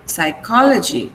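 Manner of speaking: "psychology" stressed on the KO; "psychology" with weight on its second syllable; stress before -logy. Shown as a demonstration. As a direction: say psy-KO-lo-gy, second syllable strong. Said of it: "on the preceding syllable".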